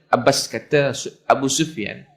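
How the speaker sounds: ordinary delivery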